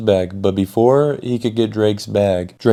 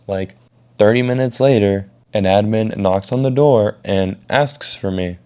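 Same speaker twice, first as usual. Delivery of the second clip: ordinary talk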